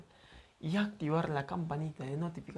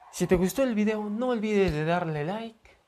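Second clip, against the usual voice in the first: upbeat, louder and higher outro; energetic sign-off; 6 semitones higher, 8 dB louder than usual. Real, not acted. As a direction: pump up the like request. Channel sign-off. (despedida del canal con energía)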